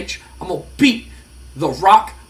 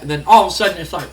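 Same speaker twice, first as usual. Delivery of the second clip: usual speaking voice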